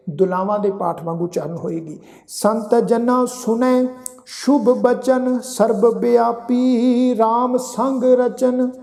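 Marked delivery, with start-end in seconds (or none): 2.3-8.7 slow, drawn-out sacred recitation; measured Gurbani reading, sustained line endings; scripture recitation. Shown as a demonstration. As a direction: chant each phrase slowly with long held vowels. Recites scripture for pronunciation lesson.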